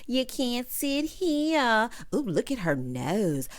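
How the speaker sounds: drawn-out catty taunt dropping toward whispery gossip; mock mean girls. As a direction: imitate catty cafeteria gossip, stretched out then hushed. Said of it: "cackling like mean girls in the cafeteria".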